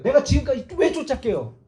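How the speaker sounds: aggrieved, pleading argument; indignant remonstration. Acted. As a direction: argue back like a betrayed follower. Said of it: "따지는 거예요".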